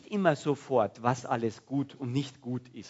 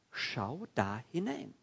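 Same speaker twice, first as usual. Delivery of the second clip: drawn-out, word-by-word urging; slow, deliberate invitation to look; slow emphatic repeat; 6 dB quieter, slower than usual. Acted. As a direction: stretch out SCHAU, DA and HINEIN, each on its own. Same command repeated slowly for emphasis.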